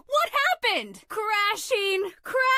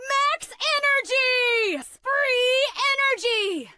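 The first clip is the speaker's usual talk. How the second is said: high, drawn-out excited shout; turbo-charged battle cry; hyped-up cheer; 3 semitones higher, slower than usual. Acted. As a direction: stretch it out in an energy rush. Hyperactive character powering up.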